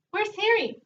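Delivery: high squawky parrot call. Acted. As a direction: squawk the name like a talking bird. Parrot speaks in story.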